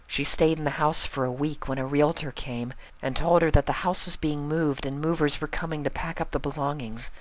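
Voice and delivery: a shy pony recounting sad news. Acted. Fluttershy tells the story.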